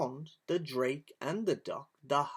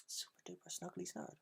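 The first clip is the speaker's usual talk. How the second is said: soft, quick whispered repeat of snurd; puzzled whisper; 15 dB quieter, faster than usual. A narrator's reading. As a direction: mutter "snurd" to yourself, baffled. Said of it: "narrator repeats puzzled".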